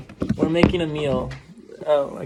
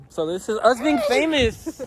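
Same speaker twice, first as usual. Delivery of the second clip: higher, hammed-up celebrity tone; mock famous; 7 semitones higher, 4 dB louder than usual. Showing off to the camera as if already famous, mock grand. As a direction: play the big star as a joke. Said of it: "She has a feeling that we're gonna be famous".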